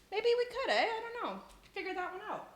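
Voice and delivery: high sing-song, teasing lilt; playful musing. Pretend. Streamer teased about a counter for the Canadian, high playful musing. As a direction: muse in a high, playful pitch.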